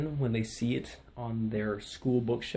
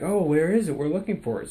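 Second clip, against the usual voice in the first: higher, louder opening, easing off; retold puzzled search; 6 semitones higher, 4 dB louder than usual. Staff searching for the ordered book, retold puzzled search. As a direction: act out someone hunting for a lost item.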